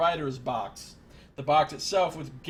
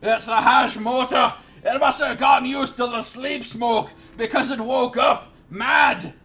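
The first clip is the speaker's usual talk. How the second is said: much higher, loud frantic shouting; terrified yell; 7 semitones higher, 9 dB louder than usual. Acted. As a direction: blurt the horror out. Elf yelling in terror.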